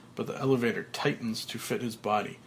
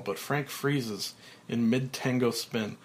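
ordinary delivery, nothing marked

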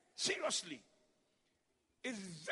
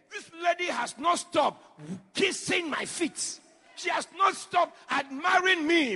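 slow, loud preaching with punched words; full-force preaching; 6 semitones higher, 10 dB louder, slower than usual. Acted. Preacher retells Jesus' words to the church, loud and slow. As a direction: preach it out to the congregation.

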